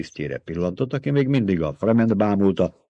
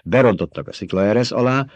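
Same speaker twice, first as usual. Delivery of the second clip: ordinary delivery, nothing marked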